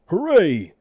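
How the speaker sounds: high, loud gleeful cheer. A narrator's reading. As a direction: cheer in triumph. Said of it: "cried Bad Fred".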